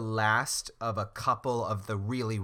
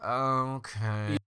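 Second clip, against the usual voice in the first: reluctant. Retold or imitated. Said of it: "reluctant, she's like".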